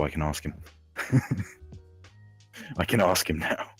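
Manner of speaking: usual speaking voice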